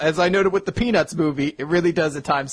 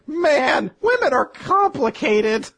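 high, slow mock voice of a baffled guy; clueless guy; 11 semitones higher, 4 dB louder, much slower than usual. Friend doing a dopey guy, high and slow, baffled. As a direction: sigh it out like a dense guy who just doesn't get women. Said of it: "He's like".

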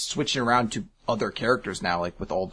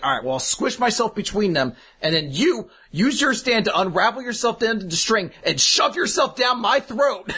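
excited; 10 semitones higher, 4 dB louder than usual. Retold or imitated. Character's plan, retold.

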